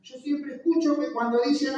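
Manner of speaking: ordinary talk, nothing marked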